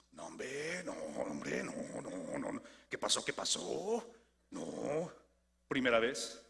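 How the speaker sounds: put-on Pedro denying in a lightly done Mexican accent, low and hushed; nervous Mexican-accented denial, no hombre no no; Mexican accent Peter. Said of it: "Pedro tiene un acento mexicano".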